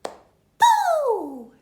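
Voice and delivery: put-on very high, squeaky baby boo. Baby answers the burglar, squeaky. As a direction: chirp it like a toddler. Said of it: "says the baby".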